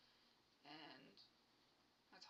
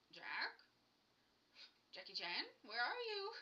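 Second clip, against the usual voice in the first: louder, rising call for a lost cat; 3 semitones higher, 11 dB louder than usual. Retold or imitated. Retelling searching for her cat, calling loudly.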